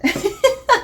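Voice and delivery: a quick giggle that rises in pitch; delighted high giggle; excited giggle. Real, not acted. Giggle over the snacks.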